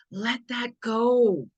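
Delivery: drawn-out, calming let-go; slow release. Real, not acted. To a worried viewer, slow calming release. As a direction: ease them into letting go slowly. Urging listener to release fear.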